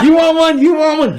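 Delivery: high, loud teasing taunt. Retold or imitated. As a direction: dangle it in the teacher's face. Taunting the teacher.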